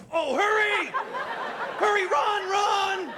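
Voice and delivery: high shrill-pitched urging to flee; panicked yell. Pretend. Urging the woman to flee, a high panicked yell. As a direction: scream at her to run. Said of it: "I'd be like".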